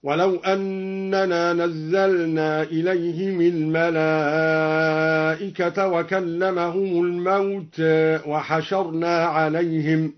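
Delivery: الموتى read with a slight lean toward e; slow careful tajweed reading of الموتى; recitation with taqlil. Shown as a demonstration. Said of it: "والتقليل في الموتى هكذا".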